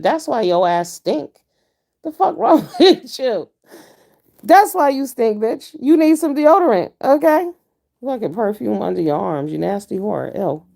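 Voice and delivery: loud, high, laughing scold; telling her off. Retold or imitated. Driver scolding the smelly woman, loud and high. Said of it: "And I said".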